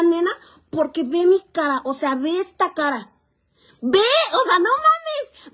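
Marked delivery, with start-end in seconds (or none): 3.8-5.5 high loud disbelief; shocked. Real, not acted. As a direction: burst out in shock. Shocked at own face.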